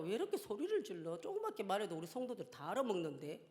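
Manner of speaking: soft, muttered self-reproach; softly chiding oneself; quiet self-talk. Retold or imitated. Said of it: "내가 나에게 하는 말이에요".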